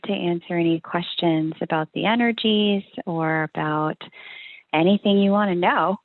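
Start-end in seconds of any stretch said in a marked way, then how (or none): none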